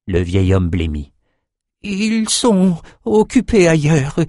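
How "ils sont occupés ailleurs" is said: put-on high, slow, evasive old man; nervous servant. A narrator's reading. Old cook lying to his king, high and nervous. Frightened slave lies.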